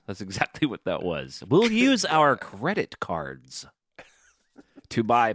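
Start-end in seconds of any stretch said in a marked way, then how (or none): 1.5-5.3 slow, drawn-out fake American; mock American accent. Irish podcaster mocking American habits, slow mock American accent. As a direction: stretch out a bad American accent. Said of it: "an Irish person doing an American accent".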